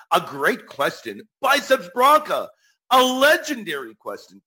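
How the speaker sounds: loud, high hype announcer call; excited shout-out. Real, not acted. Host praising a listener's great question, excited shout-out.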